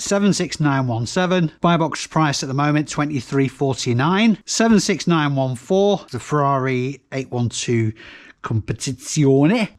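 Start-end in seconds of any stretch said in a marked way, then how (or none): 6.1-9.8 put-on slow, drawn-out Lancashire accent on Competizione; lightly put-on Lancashire accent, half joking. Reseller reading out a Lego Ferrari set, light Lancashire accent. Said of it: "in a Lancashire accent".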